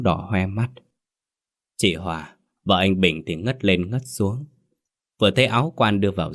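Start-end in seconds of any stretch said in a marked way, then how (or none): none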